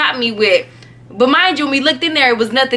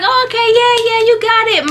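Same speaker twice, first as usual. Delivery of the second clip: high, hammed-up approval; mock enthusiasm; 10 semitones higher, faster than usual. Girl humoring her friend about a bird, mock enthusiasm. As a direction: play along in a high-pitched voice. Repeats own cheering on friend.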